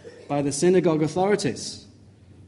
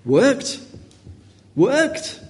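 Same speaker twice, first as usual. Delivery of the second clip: high, rising disbelief, climbing higher on the repeat; scandalised, pitched up sharply; incredulous echo; 10 semitones higher than usual. Acted. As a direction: question it in mounting astonishment. Acts out shocked response to manual work.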